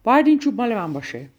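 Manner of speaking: fast, loud storybook shout; quick, forceful cry of demand. A narrator's reading. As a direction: hit the first word hard and rush on. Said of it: "فریاد زد".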